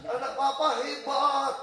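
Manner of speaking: high, loud, drawn-out praise; retold cheering shout. Dutch father to son up the tower, shouted praise. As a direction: cheer up to someone far above. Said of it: "Dia teriak".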